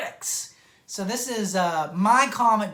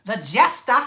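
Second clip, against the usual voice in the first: "The Jester" again in a louder Boston accent, teasing his wife; 6 dB louder than usual. Shown as a demonstration. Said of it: "How you like that, Murph?".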